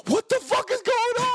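very high-pitched frantic yell; retold panicked outcry; freaked-out disbelief. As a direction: act out total bewilderment. Bewildered by fleeing driver.

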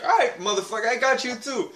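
retold taunt. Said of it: "I was like".

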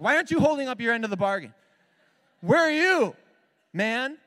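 high, fast, exasperated yell; indignant shout. Acted. Pastor hollering his complaint at God, indignant shout. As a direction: loudly demand an answer, pitch way up.

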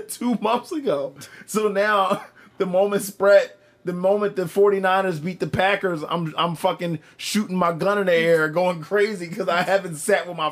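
loud and high, laughing through it. Real, not acted. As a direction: tell it while cracking up.

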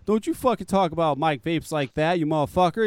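ordinary talk, nothing marked

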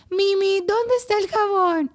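put-on high child's voice calling Mimi; mock child calling. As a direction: call for mom like a needy kid. Kids calling mom upstairs.

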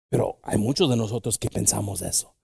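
usual speaking voice

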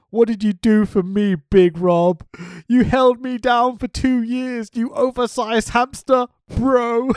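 loud, high-pitched wrestler promo; hammed-up douchebag wrestler calling out a rival; Robbie E. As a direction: put on a big mock promo voice. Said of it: "I can't do this justice".

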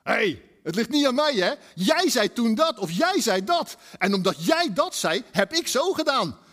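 one spouse blaming the other in a fight; quarrel voice. Retold or imitated. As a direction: insist it's not your fault. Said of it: "Als je in een ruzie zit".

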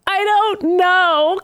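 high, slow, mock-clueless answer; Moira's helplessness. Moira answers Beast with no plan, comically helpless.

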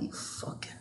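barely audible, whispered swearing; muttered curse. Real, not acted. Breaking off to swear privately before moving on, whispered curse. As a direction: curse under your breath.

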